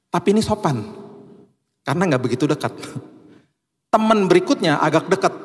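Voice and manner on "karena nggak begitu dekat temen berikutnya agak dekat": ordinary delivery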